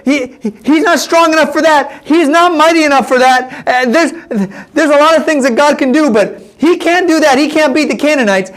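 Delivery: high, insistent acted refusal; vehement objection. Spy speaking up against Caleb, vehement objection.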